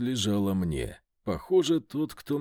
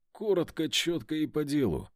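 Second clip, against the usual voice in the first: ordinary delivery, nothing marked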